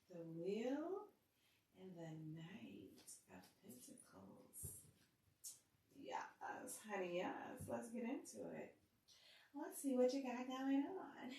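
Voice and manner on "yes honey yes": normal talking voice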